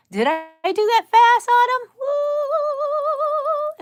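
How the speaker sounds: very high, loud whoop; excited cheer. Real, not acted. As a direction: cheer like you just won. Thrilled cheer at chat.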